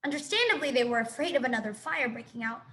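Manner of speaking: ordinary delivery, nothing marked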